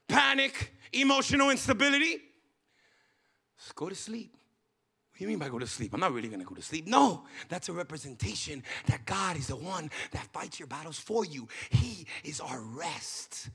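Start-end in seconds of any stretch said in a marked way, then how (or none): 3.6-13.5 soft, low, restful delivery; hushed preaching. Real, not acted. Preacher telling church God is their rest, hushed and calm. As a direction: drop right down to calm them.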